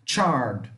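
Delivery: "Chard" with a tch start and minimal D; careful teacher's model of "Chard", tch at the front; faint final D. Shown as a demonstration. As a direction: let "Chard" fall away to a barely audible D. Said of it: "É mínimo o som dele".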